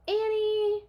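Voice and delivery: high, loud call; loud searching call. A narrator's reading. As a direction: call for her in the dark. Jack calls again.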